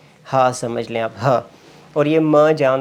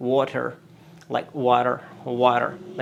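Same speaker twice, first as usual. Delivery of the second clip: American WATER with the final r sounded; American accent; 3 semitones lower, much slower than usual. Shown as a demonstration. Teacher shows how Americans say water, American accent. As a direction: say WATER like an American, r-coloured ending. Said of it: "if you go for American accent, you would say".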